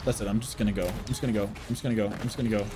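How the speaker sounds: low, soft repeated mumble; quietly bailing out; resigned muttering. Real, not acted. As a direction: repeat it low under your breath. Player gives up and slips away.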